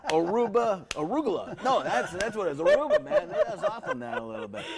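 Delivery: boastful Trump rambling about arugula, pitch climbing; Trump impression. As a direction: brag about lettuce like a president.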